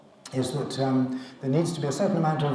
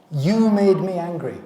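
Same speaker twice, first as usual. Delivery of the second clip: higher, louder blaming line; blaming outburst; 4 semitones higher, 7 dB louder than usual. Acted. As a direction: snap the blame out at someone. Acting out a blaming person.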